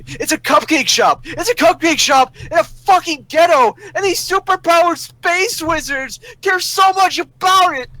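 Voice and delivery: can't believe this is real; exasperated rant. Real, not acted. Ranting about the cupcake shop.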